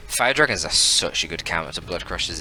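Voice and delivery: usual speaking voice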